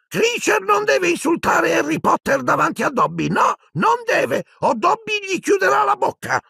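very high, indignant elf shriek; Dobby, shrieking. Acted. House-elf defends Harry Potter against an insult, shrieking. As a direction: squeal it out, drawing out the refusals. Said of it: "strillò Dobby".

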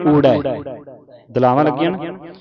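ordinary talk, nothing marked

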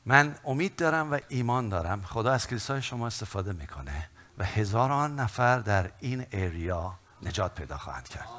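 usual speaking voice

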